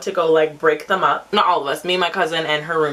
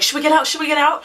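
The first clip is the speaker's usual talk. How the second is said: nervous cousin; 10 semitones higher, faster than usual. Retold or imitated. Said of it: "my cousin's like".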